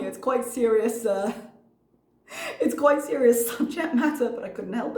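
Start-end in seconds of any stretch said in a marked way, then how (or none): 2.6-4.3 laughing. Real, not acted. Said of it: "I shouldn't find it funny".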